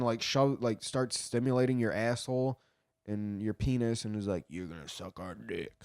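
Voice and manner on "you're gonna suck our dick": very quiet, low creepy murmur; creepy old man. Acted. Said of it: "some creepy old man".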